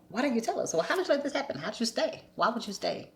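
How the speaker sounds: high-pitched imitation of reproachful family; incredulous questioning. Imagining what family would ask her, incredulous.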